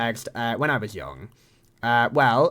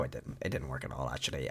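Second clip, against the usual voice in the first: much lower and softer, almost under the breath; quiet admission; 11 semitones lower, 14 dB quieter than usual. Real, not acted. Owning up that it didn't work, very quietly. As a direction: drop right down for a sheepish retraction. Takes back his own claim.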